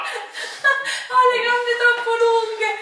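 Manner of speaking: slow high whine through laughter; laughing whine. Real, not acted. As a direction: stretch it out, whining and laughing. Excuse after falling.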